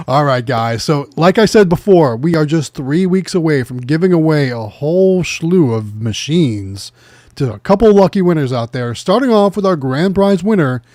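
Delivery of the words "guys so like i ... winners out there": usual speaking voice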